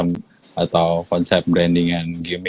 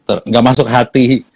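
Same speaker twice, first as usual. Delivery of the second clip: ordinary talk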